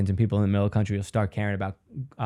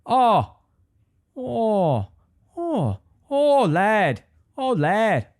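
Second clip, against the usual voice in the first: high, falling Irish exclamations; mock Irish accent; 9 semitones higher, much slower than usual. Comedian trying one-noise accents, mock Irish accent. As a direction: pitch it way up as a hammed-up Irishman. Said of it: "That's an Irish one".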